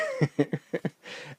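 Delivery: high trailing giggle; amused laughter. Real, not acted. Husband who didn't know wife was there, giggling. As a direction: chuckle on at your own blunder. Laughs at his own story.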